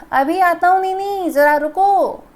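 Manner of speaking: calling out loudly from inside. Acted. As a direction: shout so he hears you at the door. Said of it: "ज़ोर से". (ज़ोर से पुकारते हुए)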